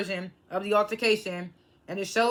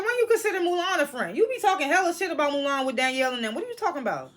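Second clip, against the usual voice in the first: much higher, indignant sass; incredulous disbelief; 6 semitones higher, faster than usual. Retold or imitated. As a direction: throw her words back at her in disbelief. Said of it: "And I'm just like".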